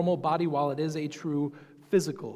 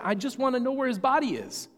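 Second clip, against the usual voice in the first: casual, as if nothing strange happened, higher; matter-of-fact; 9 semitones higher, 4 dB louder than usual. Retold or imitated. Mary unfazed by angels, answering them casually. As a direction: toss it off like an everyday question. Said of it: "acts like it's perfectly normal".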